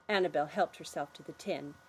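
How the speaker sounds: usual speaking voice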